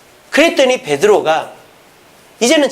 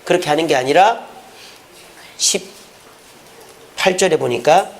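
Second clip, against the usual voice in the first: ordinary talk, nothing marked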